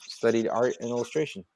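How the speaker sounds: usual speaking voice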